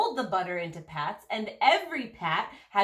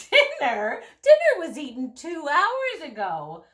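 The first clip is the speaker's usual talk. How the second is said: mock astonished; 7 semitones higher, 6 dB louder than usual. A narrator's reading. Housekeeper exclaims, scolding.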